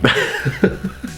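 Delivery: breathy laugh at his own impression; helpless little laugh. Real, not acted. Laughs at own accent attempt.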